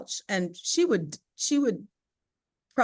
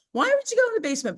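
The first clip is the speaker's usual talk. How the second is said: high, fast disbelieving question; quick, high-pitched mock astonishment; mock incredulous friends; 13 semitones higher, much faster than usual. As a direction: imitate friends squealing in disbelief.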